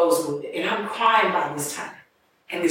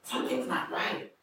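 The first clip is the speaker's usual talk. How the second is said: hushed concern; 4 semitones higher, 9 dB quieter than usual. Retold or imitated. Strangers checking on her.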